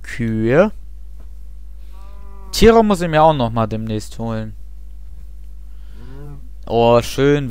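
normal talking voice

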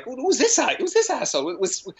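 squeaky, put-upon band member griping; an outraged squeaky band member whining about the new guy; indignant high mock voice. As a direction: play a high-pitched, offended musician.

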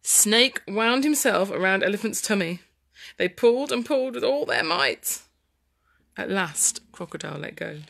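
put-on lively story narration for children; dramatic storytelling. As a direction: read the tussle with big dramatic swings.

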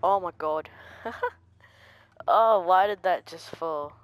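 loud, startled outburst; jolted by a sudden scare. Real, not acted. Player reacting to something suddenly falling, startled. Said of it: "that scared me".

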